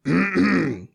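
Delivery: a sharp throat clear to warm up; throat clear before a test call. Real, not acted. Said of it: "let's have a little test run".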